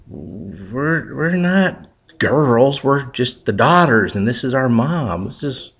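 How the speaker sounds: slow indignant high protest; indignant daughters. Acted. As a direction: correct the rude bird, offended.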